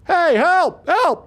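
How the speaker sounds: high calling shout for help. Retold or imitated. Dudley chasing the car, calling out high for help. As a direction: yell after the car to stop it.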